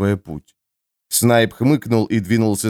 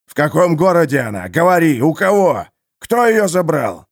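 гневный допрос; 5 semitones higher than usual. Acted. Харман допрашивает, избивая пленника.

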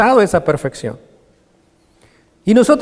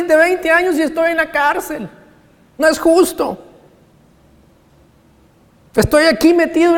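mock indignant complaint; 8 semitones higher than usual. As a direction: rail at the unfairness like a self-pitying prisoner. Mock complaint Paul never made.

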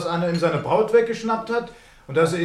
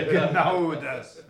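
amused laugh; 4 semitones lower, 4 dB quieter, much slower than usual. Acted. Frau amüsiert über Mitbürgerpflichten.

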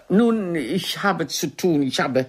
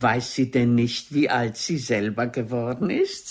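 imitating his thoughts; 7 semitones lower than usual. Actress voicing the old doctor's unspoken thoughts, lower and mocking. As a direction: voice dropped lower, mocking him. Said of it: "So denken Sie".

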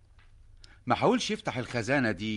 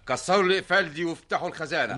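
urgent command; 6 semitones higher, faster than usual. Acted. King orders the locks broken.

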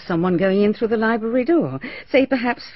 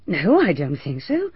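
ordinary talk

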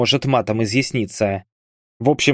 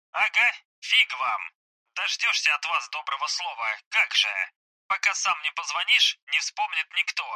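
soft, higher, thin phone-filtered voice; voice on speakerphone; 5 semitones higher, 7 dB quieter than usual. Acted. Hospitalised soldier reporting to his comrades, telephone voice. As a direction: talk as if coming through a phone speaker. Bars heard over speakerphone.

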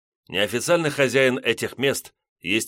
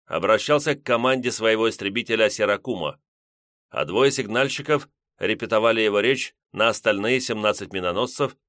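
ordinary delivery

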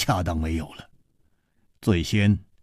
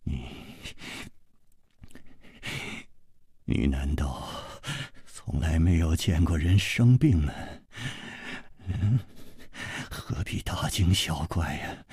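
weak, quiet reply with broken pauses; faint, halting reassurance; forced smile; 7 dB quieter than usual. Acted. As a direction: force a smile while feeling very ill. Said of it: "却还是勉强地笑着".

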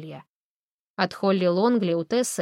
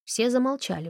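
ordinary delivery, nothing marked

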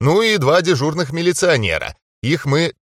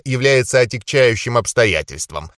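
ordinary delivery

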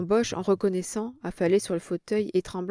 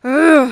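loud raw grunt of shock; a rough cry of alarm; harsh shocked bark; hoarse startled exclamation; 8 semitones higher, 15 dB louder than usual. A narrator's reading. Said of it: "une rauque exclamation".

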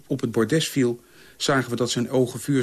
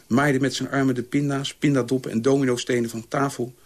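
ordinary delivery, nothing marked

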